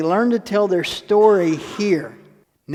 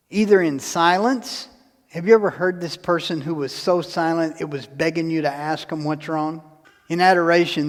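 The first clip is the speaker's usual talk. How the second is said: ordinary talk, nothing marked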